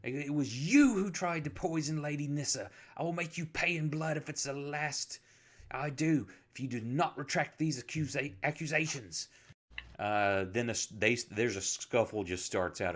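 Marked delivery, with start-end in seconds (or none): 0.0-9.3 angry retort. Acted. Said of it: "And then Luther retorts".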